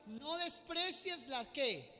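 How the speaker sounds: emphatic Bible proclamation at full voice; forceful scripture reading. Real, not acted. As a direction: declaim the verse to the whole congregation.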